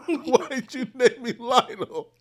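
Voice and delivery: high, loud, slow whine breaking into laughter; mock crying. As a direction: whine it as a wronged small child. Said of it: "I started crying".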